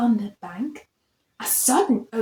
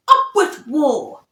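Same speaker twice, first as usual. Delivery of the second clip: louder rallying cry, fierce; shouted slogan; 2 semitones higher, 4 dB louder than usual. A narrator's reading. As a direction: rouse the pack to war. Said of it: "And they shouted".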